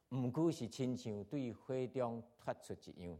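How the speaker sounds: steady, measured Bible reading to the congregation; scripture reading. Acted. Pastor reading First Corinthians to the church, steady and measured. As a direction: read the passage out evenly, verse by verse.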